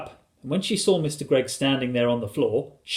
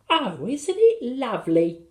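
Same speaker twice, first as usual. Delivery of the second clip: put-on high, drawn-out little-boy rapture; high-pitched, gleeful boy; delighted child's cry; 11 semitones higher, 3 dB louder than usual. A narrator's reading. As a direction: exclaim it with wonder. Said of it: "cried William".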